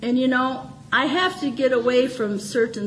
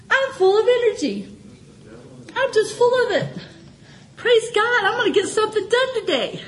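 excited prayer; 9 semitones higher than usual. Retold or imitated. Her prayer, full of energy.

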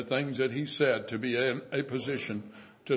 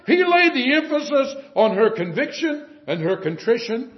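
loud, raised, driving sermon voice; fervent preaching; 7 semitones higher, 7 dB louder than usual. Acted. Preacher telling the congregation sin is sin, loud fervent preaching. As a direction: hammer the point home from the pulpit.

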